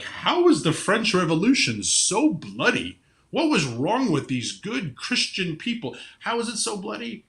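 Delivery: lightly put-on shocked innocence; mock incredulous question. Mimicking puzzled people looking back at history, mock-baffled.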